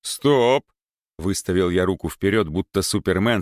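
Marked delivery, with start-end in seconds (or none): 0.0-0.7 sharp shout, higher and louder. Acted. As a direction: stop her with a shout. Stops approaching girl.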